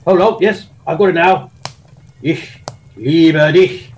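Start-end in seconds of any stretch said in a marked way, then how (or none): 2.2-3.9 slow, forceful German declaration; harsh German. Shown as a demonstration. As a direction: say it like a barked German order. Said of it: "See what I mean?".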